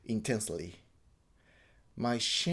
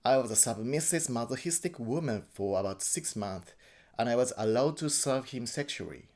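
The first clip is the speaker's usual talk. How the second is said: ordinary delivery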